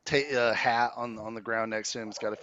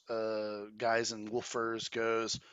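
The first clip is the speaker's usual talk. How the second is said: usual speaking voice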